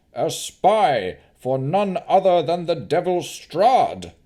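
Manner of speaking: high, loud, accusing oratory; pompous noble slowing on his charge; scheming Baron. Acted. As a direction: whip up the crowd against her. Baron denounces Navara.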